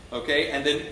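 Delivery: normal talking voice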